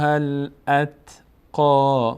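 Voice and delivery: "الاتقا" slowly, ending on a full long aa. Shown as a demonstration. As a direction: draw out the final "قا" of "الاتقا" as aa.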